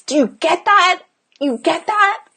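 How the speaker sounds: high, insistent questioning; pressing check. Real, not acted. Coach checking that the audience understands, insistent. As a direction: lift it up and press the listener.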